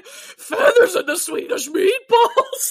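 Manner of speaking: high, slow laughing Countess voice; Countess's line. Retold or imitated. Savoring the Countess's favorite line, high slow laughing quote. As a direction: drag it out through laughter, mock horror. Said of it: "The way she delivers this line".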